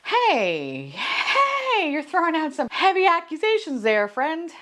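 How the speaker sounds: high, sing-song soothing voice; calm her down, playing it sweet; mock soothing friend. [name] soothing a drunk Lindsay, high mock-calm voice.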